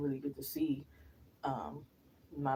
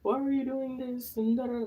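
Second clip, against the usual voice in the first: whiny plea; 10 semitones higher, 7 dB louder than usual. Retold or imitated. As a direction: stretch it out like a hurt lover. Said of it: "He must thought I was gonna ... be like".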